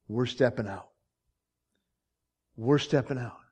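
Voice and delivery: sudden drop to low, soft declaration; quiet resolve. Acted. Quiet resolve after preaching climax.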